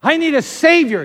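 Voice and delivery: high, loud plea; acted urgent appeal; pleading demand. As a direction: cry out the need.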